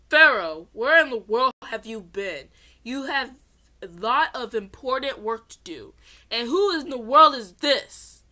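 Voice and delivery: loud scolding priest voice; angry priest. Acted. Priest Seto scolds the late pharaoh, loud and stern. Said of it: "this is Seto's voice".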